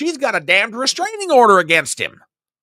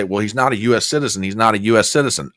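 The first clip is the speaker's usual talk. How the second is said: mocking himself; 10 semitones lower, faster than usual. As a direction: lower and fast, parroting himself dismissively. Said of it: "you guys are hearing me say".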